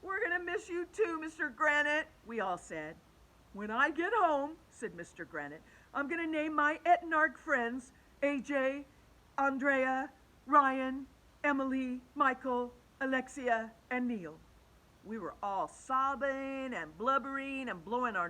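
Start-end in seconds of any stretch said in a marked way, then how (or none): 0.0-2.1 put-on high, wailing kids' farewell; emotional children. A narrator's reading. Kids answer a tearful farewell. 3.5-4.6 higher, louder farewell voice; fond teacher. A narrator's reading. 5.9-14.4 higher, louder roll call of names; fond teacher. A narrator's reading. Teacher's farewell to class.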